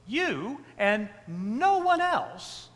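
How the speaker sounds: "You and no one else" slow and high, stress on "no"; emphatic you. Shown as a demonstration. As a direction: drag out "You and no one else", leaning hard on "no". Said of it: "it's in the emphatic".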